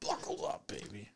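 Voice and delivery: breathy whispered tease. Acted. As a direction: whisper it close and sly. Joking to buckle in.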